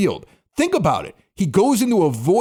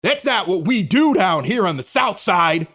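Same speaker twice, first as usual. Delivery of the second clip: raised, exaggerated South Side accent; weird South Side accent; 4 semitones higher than usual. Mocking the announcer playing to Southsiders, fake South Side accent. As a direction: put on a fake South Side Chicago bluster. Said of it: "go into that weird accent".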